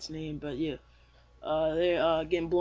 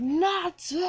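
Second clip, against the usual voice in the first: high, loud, drawn-out scream of a name; yell; 12 semitones higher, 5 dB louder than usual. Retold or imitated. Cat yelling as his friend goes off the cliff, screaming. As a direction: scream it out as your friend falls. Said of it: "Happy yells".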